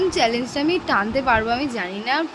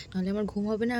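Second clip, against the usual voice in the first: usual speaking voice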